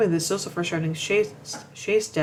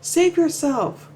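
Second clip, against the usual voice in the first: high, loud, drawn-out cry; yelled warning; 10 semitones higher, 6 dB louder, much slower than usual. Retold or imitated. Jojen on his back yells to Bran, yelled warning. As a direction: yell it out long and urgent. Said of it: "He yells to Bran".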